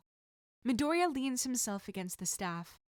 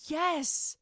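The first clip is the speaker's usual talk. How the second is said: eager burst, higher and louder; excited yes; 8 semitones higher, 5 dB louder than usual. A narrator's reading. Boy eagerly accepting weapons training, excited higher shout. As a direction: blurt it out, thrilled. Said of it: "Izuku is vibrating with excitement".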